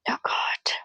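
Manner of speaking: whisper. Acted. Family shocked by the child.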